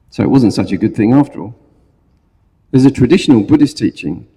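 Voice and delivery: ordinary delivery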